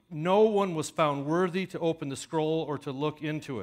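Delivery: the verse read out loud and high. A narrator's reading. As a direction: proclaim the verse with grief. Reading of verse four.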